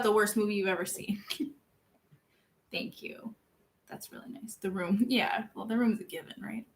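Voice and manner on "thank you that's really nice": whispered, bashful thanks; embarrassed whispered thanks; shy whisper. Real, not acted. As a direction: breathe it out softly. Flustered by compliments from chat.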